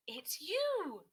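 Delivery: loud, high cry of discovery; excited hoot. A narrator's reading. Otis finding the mystery bird, loud excited hoot. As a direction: hoot it out in delight. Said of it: "Otis hooted".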